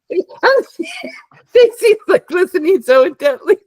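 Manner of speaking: high, laughing through the words; helpless laughter. Real, not acted. Host amused at own Simpsons rambling, helpless laughter. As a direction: talk while cracking up.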